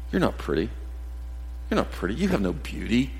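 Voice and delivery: soft, taunting put-down; cruel whisper. Retold or imitated. Satan whispering to young girls, quiet and cutting. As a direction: murmur it to wound. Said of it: "Satan whispers to our young girls".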